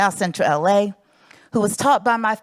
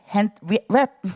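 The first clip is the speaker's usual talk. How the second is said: ordinary talk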